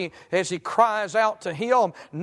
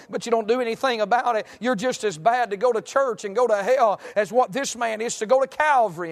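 insistent, higher-pitched pulpit exhortation; fervent preaching; 4 semitones higher than usual. Real, not acted. Preacher confronting religious churchgoers who never respond, fervent exhortation. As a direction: drive it home in long unbroken phrases.

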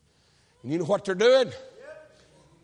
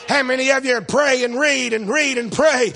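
usual speaking voice

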